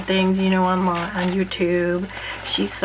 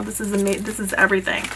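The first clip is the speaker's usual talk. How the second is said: ordinary delivery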